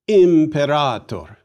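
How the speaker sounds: Imperator slow and loud, im-peh-RAAH-tor; long A. Shown as a demonstration. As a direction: model "Imperator" firmly, stretching the stressed A.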